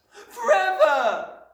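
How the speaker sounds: very high, drawn-out wail; little girl's loud, high outburst; anguished cry. A narrator's reading. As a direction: wail it loudly like a small child. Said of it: "Matilda cried".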